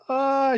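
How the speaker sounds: high, brief mock wail; mock falling cry. Host acting out Claire falling off a cliff, mock cry. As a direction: imitate someone crying out as they fall. Voices Claire as she falls.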